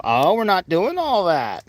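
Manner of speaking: ordinary delivery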